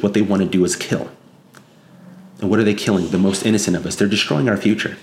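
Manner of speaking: normal talking voice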